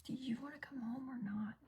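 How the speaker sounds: hushed, exasperated whisper; urgent whisper. Retold or imitated. Mom whispering to her son at the school office, exasperated. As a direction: mutter it under your breath so staff miss it.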